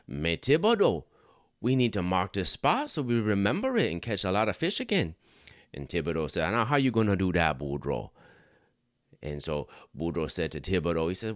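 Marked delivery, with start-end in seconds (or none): 0.1-5.2 put-on Boudreaux calls out in broad Cajun accent. Boudreaux to Thibodeau while fishing, thick Cajun accent. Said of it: "the best Cajun accent I got". 6.5-8.1 put-on Cajun accent. Thibodeau questioning Boudreaux, thick Cajun accent. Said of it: "the best Cajun accent I got".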